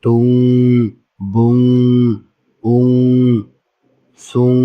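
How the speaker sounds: syllables like "টুং" with the final nasal held; ghunnah drill. Shown as a demonstration. Teacher reading out for learners, ghunnah held on "সুং". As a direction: hum on through the end of each, as in "বুং". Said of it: "গুণা করতে হবে".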